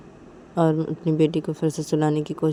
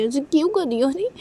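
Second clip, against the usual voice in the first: higher pleading voice; higher, coaxing plea; 10 semitones higher, 4 dB louder than usual. Acted. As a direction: beg her in a raised girlish voice. Pleading girlfriend quarrel.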